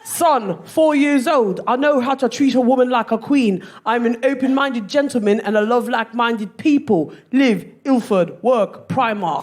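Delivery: broad put-on London accent; mock London accent, each item announced; guessed London accent. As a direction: read it like a London lad's dating profile. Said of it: "guess what his accent is".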